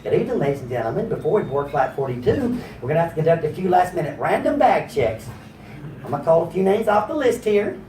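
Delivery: low, soft Southern cabin announcement; Southern-accented stewardess on the intercom. Retold or imitated. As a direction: make a polite Southern flight-attendant announcement. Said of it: "the southern stewardess going".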